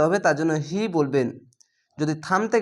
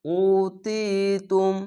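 slow recited উতিতুম; Quran recitation; much slower than usual. Shown as a demonstration. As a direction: draw out উতিতুম. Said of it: "এক আলিফ টেনে পড়বেন". (ধীরে টেনে উতিতুম)

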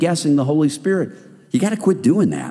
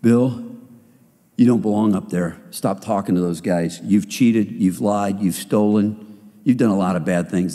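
a hint of a low accusing devil; 6 semitones lower than usual. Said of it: "Satan's voice in our head".

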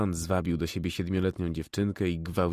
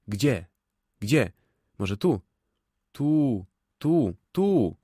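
urgent searching; 4 semitones higher, 5 dB louder, much slower than usual. A narrator's reading. Searching ambulance, urgent calls.

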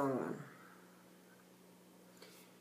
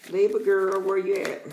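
loud, higher-pitched call; raised voice, hailing a friend; playful calling out; 5 semitones higher, 7 dB louder than usual. Pretend. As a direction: call out as if hunting for someone. Calling out to the Libra viewer.

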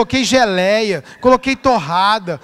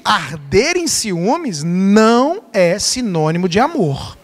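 slow emphatic statement; 4 semitones lower, slower than usual. Acted. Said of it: "repeats key point".

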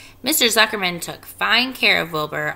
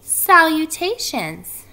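high, drawn-out greeting; small voice; 9 semitones higher, 7 dB louder, slower than usual. A narrator's reading. Tiny spider greets Wilbur from above, small high voice. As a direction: sing out the word slowly in a little high voice. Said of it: "he heard a small voice".

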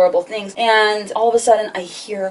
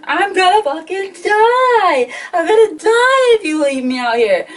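high, loud, slowed panicked wail; pitched-up hysterical plea; retold screaming; 10 semitones higher, 4 dB louder than usual. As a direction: scream down the phone in terror. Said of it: "I'm literally screaming".

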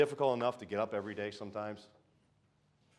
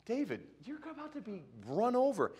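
mock warning; 9 semitones higher than usual. Preacher voices a warning friend.

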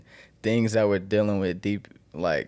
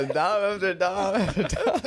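laughing hype; 10 semitones higher than usual. Real, not acted. Hyping up friend's quotable lines.

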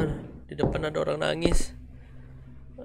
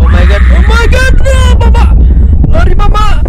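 very high, full-blast panicked shrieks; panicked screaming; 22 dB louder than usual. Real, not acted. Streamer flees a figure in the horror game, panicked screaming. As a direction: scream for mum while running for your life.

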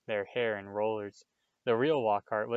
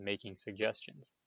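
plain reading voice

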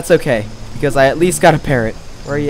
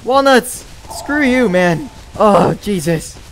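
startled; 7 semitones higher, slower than usual. Real, not acted. Streamer scared by a sudden creature, startled. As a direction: jump at the fright. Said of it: "you scared me".

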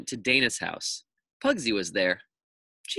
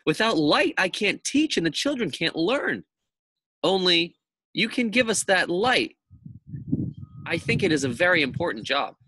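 put-on higher, insistent teacher voice, lecturing a doubtful boy; Missus Jules, firm teacher; 7 semitones higher, 3 dB louder than usual. Missus Jules insists to Myron, higher and firmer. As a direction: press the point like a strict teacher.

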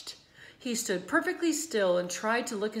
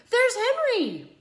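put-on much higher, louder girlish call; excited call; 9 dB louder, slower than usual. A narrator's reading. Girl spotting her friend at the fair, excited.

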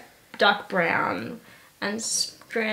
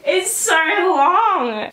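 slow, drawn-out whiny wail; mock whine; 7 semitones higher, 5 dB louder, much slower than usual. Real, not acted. Drawn-out wail about hair.

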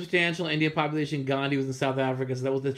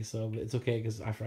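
usual speaking voice

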